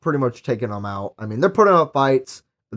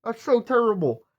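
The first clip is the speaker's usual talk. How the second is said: mock shocked; 8 semitones higher than usual. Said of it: "I'd be like".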